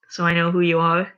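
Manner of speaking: plain reading voice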